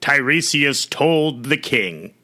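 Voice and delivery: ordinary delivery, nothing marked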